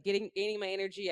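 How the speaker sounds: ordinary talk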